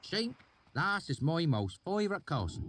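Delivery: high rustic voice; put-on valley dialect. A narrator's reading. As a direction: fake the local dialect. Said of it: "change in dialect".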